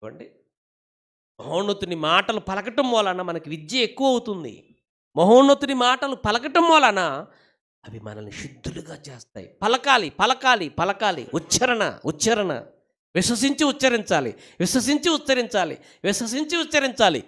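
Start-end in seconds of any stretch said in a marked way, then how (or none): 1.4-7.0 raised preacher's voice, building louder on the repeat; rising preaching fervour. Real, not acted. 9.6-17.2 high, driving repetitions with falling ends; exhorting preacher. Real, not acted.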